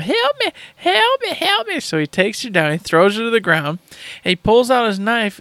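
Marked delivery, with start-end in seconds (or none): 0.0-1.8 very high falsetto help me, fading away; damsel crying out. Retold or imitated. Tied-up woman left on the horse keeps calling, high falsetto wail. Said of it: "crying out".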